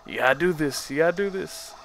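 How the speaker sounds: badly faked boxing-movie pep talk; soft, slurred tough-guy urging, rising in pitch; Stallone. Trainer psyching up a fighter, terrible impression. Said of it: "That was a terrible Stallone impression".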